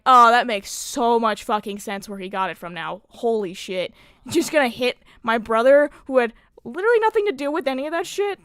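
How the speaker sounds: loud, incredulous sarcastic rant; retold outrage. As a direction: rant in disbelief, rising at the end. Acts outraged reaction to mom's revelation.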